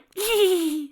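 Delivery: high giggle through fake tears; teary giggle. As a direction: giggle while pretending to cry. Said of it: "He giggled through crocodile tears".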